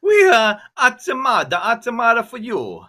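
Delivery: loud, high, drawn-out Italian caricature; big cartoon Italian shout; mock Italian accent. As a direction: ham up an Italian accent, pitched high and stretched out.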